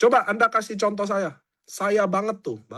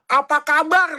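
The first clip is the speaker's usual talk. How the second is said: mock sweet greeting; 9 semitones higher, 6 dB louder than usual. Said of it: "terlalu sopan".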